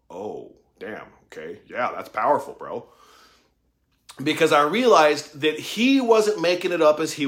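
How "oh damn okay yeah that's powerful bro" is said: low, quiet, awed reaction; re-enacted humbled murmur; stunned realization. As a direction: let it sink in, hushed and low. Retelling his stunned reaction.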